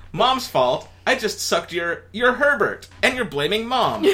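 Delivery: outraged disbelief; indignant Lynn. Acted. Lynn voiced.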